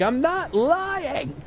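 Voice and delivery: very high, slow whining protest; whiny kid. Retold or imitated. Boy pleading with workers, high slow whine. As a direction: stretch it out like a desperate whining child. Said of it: "And I'm like".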